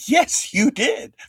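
high, slow, mock-insistent Yes, you did; stubbornly correcting the editor, slow and high; insistent retort. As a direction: drag it out like an exasperated reply. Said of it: "I said".

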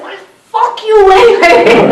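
high, loud, slow furious scream in a big Irish brogue; screamed curse at the moon. Retold or imitated. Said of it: "screamed up at the moon".

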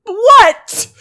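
high, loud startled what; defensive outburst. Acted. Lou answers teasing friends, loud and defensive. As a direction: burst out, caught off guard. Snaps back at being teased.

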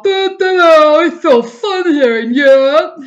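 mock giddy husband. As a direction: mimic a husband gushing about Europe. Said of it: "While Mister Sith is all".

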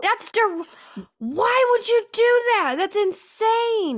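high, loud, stretched-out incredulity; appalled disbelief. Real, not acted. Host appalled that he recorded himself yelling, incredulous. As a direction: exclaim it, truly aghast.

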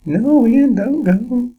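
very high falsetto cry; high-pitched plea. Pretend. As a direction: squeak it out as a desperate plea. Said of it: "you'll hear English Gav say".

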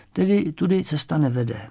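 ordinary delivery, nothing marked

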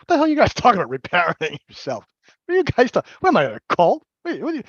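loud, high baffled protest; incredulous. Retold or imitated. Hearing about reparenting, loud baffled scoffing. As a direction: scoff at the strange idea.

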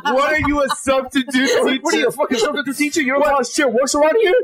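high-pitched mock outrage, half laughing; retold disbelief, pitched well up; incredulous. Husband mocking his wife as a substitute teacher, incredulous.

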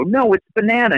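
put-on high British child saying banana; daughter's accent. Daughter arguing with her brother over banana, high British child voice. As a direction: mimic a little British girl insisting. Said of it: "my daughter would go".

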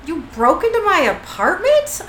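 indignant disbelief. Acted. Metatron learns Cass broke into his apartment, indignant disbelief. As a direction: act affronted by the intrusion. Acted shock at a break-in.

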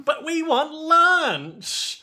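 high, loud, drawn-out mock whine; whining little helper. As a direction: wail it slowly like a tiny put-upon worker. Little Sex Pistols whine to boss.

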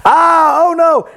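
sudden high panicked yelp; panicked cry. Acted. Preacher voicing disciples in the storm, panicked high cry. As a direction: cry out like a frightened disciple in a sinking boat.

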